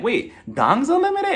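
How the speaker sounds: high, slow, incredulous question; Kyle. Retold or imitated. Friend questioning a website's name, mock incredulous. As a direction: pitch shoots up in mock surprise. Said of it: "Kyle is like".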